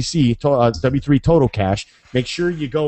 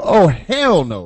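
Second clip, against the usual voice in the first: exasperated; 4 semitones higher, 5 dB louder, much slower than usual. Retold or imitated. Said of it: "Have you ever run into a".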